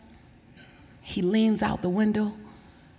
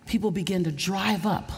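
normal talking voice

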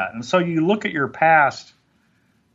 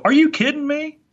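much higher, a squeaky spike of disbelief on "you"; incredulous mentor; 3 dB louder than usual. Retold or imitated. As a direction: jolt them out of their excuse. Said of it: "And he'll go".

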